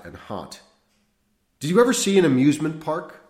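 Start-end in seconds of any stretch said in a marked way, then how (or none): 1.6-3.2 high, loud, fast; eager. A narrator's reading. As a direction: ask it eagerly. Priest eager question.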